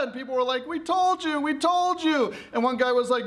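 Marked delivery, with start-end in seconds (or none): 0.7-2.3 high-pitched, wailing accusation; panicked reproach. Acted. People blaming Pandora amid the chaos, in panic. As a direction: wail it twice, pitch way up.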